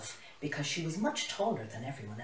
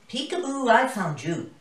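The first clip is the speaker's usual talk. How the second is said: gruff lioness greeting; 6 semitones higher, 11 dB louder than usual. A narrator's reading. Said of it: "She gruffly greeted Jilly".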